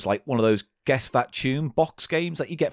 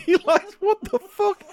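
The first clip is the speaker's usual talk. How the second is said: laughing disbelief. Real, not acted. Mocks partner's bit, laughing.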